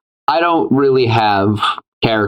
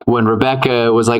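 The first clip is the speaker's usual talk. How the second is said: ordinary delivery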